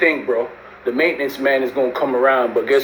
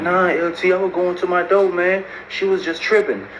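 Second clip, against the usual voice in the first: mildly imitated higher-pitched pleading; mock inmate's protest, pitched up; retold protest; 7 semitones higher than usual. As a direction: plead your case in a raised pitch.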